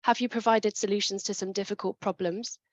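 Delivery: ordinary delivery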